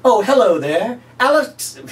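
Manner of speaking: high, slow, showy infomercial voice; cheesy pitchman. Pretend. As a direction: greet the viewer like a late night huckster. Pitchman greeting retake.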